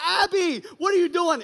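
high, loud alarmed cry; a panicked mother calling her child's name; mother shocked as daughter body-slams boy; alarmed mom. Retold or imitated. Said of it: "She's like".

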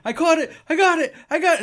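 high, loud eager yelling; kid calling for the ball. Retold or imitated. Kid in left field calling for the baseball, high excited yell. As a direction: shout like a kid sure he has the catch.

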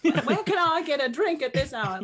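put-on a light old-Hollywood elf voice; Mid-Atlantic elf. Voice actor mocking a casting brief in a light Mid-Atlantic accent. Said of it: "we want someone Mid-Atlantic".

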